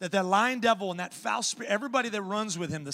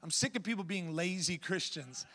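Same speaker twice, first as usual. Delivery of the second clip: normal talking voice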